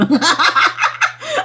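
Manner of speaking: high self-amused laugh; real high-pitched giggling burst; laughing at oneself. Real, not acted. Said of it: "I'm laughing at myself".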